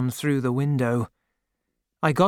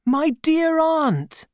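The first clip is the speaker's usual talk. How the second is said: cried out; 13 semitones higher, slower than usual. A narrator's reading. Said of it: "I cried".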